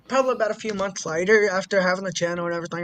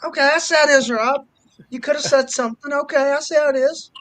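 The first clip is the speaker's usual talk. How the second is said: high-pitched playful huff; teasing grievance, pitched up; mock offended; 7 semitones higher, 4 dB louder, faster than usual. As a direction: act slighted by a buddy. Acted mock-offended reply to Rob.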